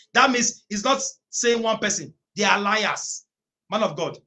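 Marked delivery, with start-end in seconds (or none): none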